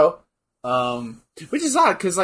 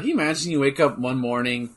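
ordinary delivery

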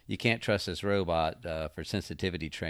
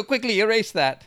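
loud joking; 13 semitones higher, 8 dB louder than usual. Real, not acted. Jokingly retracting his comment.

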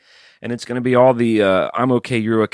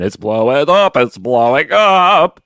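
higher, louder, slow sing-song imitation; mock hype; 6 semitones higher, 7 dB louder, slower than usual. Host imitating cohost's brag that lost subscribers, mock sing-song. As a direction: mimic the smug brag. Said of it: "after you went".

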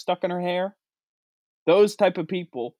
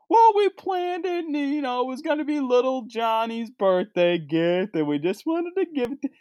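mock Disney parent; 7 semitones higher than usual. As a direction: whine the excuse like a clueless parent. Mocking parents going to Disney.

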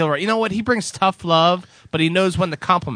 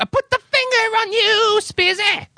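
high mock accusation; 13 semitones higher than usual. Playful accusation, pitch jumps.

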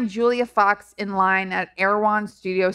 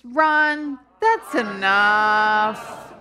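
drawn-out catchphrase; much slower than usual. Shown as a demonstration. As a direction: stretch out that's enough slowly. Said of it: "would you help me say it all together".

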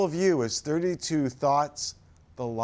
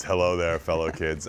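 much lower, hammed-up greeting; very deep mock voice; 11 semitones lower than usual. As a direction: drop the voice way down, mocking. Repeats lower.

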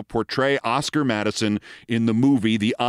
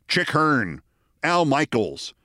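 usual speaking voice

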